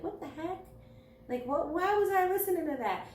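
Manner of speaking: put-on imagining looking back at old music, baffled; mock disbelief. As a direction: act baffled at your old taste. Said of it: "you're like".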